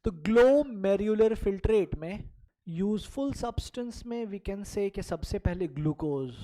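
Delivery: ordinary delivery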